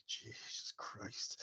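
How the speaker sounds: whispered aside; under breath. Acted. Innkeeper reacting in a whisper. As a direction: mutter it under your breath.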